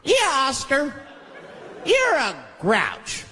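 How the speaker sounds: high, slow mocking puppet voice; singsong schoolyard taunt; taunting muppet. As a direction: sneer it like a kids' show puppet. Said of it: "They judge him right in his face".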